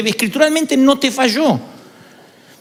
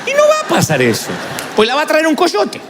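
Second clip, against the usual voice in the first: laughing punchline; 7 semitones higher than usual. Real, not acted. Preacher joking about the mother-in-law, laughing. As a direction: joke it out much higher, laughing.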